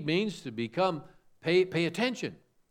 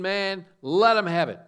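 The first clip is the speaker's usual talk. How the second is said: mock let-him-have-it; 4 semitones higher, 8 dB louder than usual. Preacher mocks confrontive attitude.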